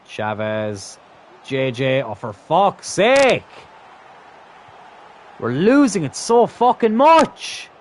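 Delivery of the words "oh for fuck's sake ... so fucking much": high, loud, drawn-out wail; raging at the game, stretching the words; frustrated yelling. Real, not acted. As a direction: yell slowly in real exasperation. Losing chance after chance.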